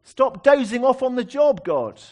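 loud, high mock rebuke of a sleeping God; rebuking God loudly. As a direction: shout him awake with bold frankness. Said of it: "saying the same thing to God".

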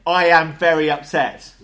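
loud emphatic declaration of outrage; very upset. Acted. Lecturer voicing what torn clothes mean, very upset. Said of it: "that is a way of saying".

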